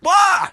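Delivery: very high, loud shouted warning; sharp shouted cry of alarm; yell. Retold or imitated. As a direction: yell to warn him of the round. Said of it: "I yelled".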